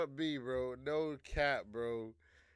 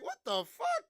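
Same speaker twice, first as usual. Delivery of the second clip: shrill, much higher yelled disbelief; mock Trump outrage; 4 dB louder than usual. Trump snapping at Elon, high outraged yell. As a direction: squeal it like an offended boss. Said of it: "Trump is like".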